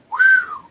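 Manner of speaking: sharp high whew of relief. Real, not acted. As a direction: blow out a whistled whew. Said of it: "that's some heavy duty shit".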